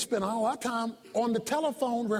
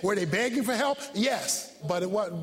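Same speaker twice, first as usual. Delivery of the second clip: ordinary talk, nothing marked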